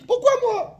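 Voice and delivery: high-pitched mock whine; the second son whining; sulky child's why me; whiny kid. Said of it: "Le deuxième, il a dit".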